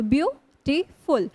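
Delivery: Beautiful in three separate beats, view - टी - फुल; syllable by syllable. Shown as a demonstration. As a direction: pause after each syllable of beautiful. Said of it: "तीन हो गए आपके".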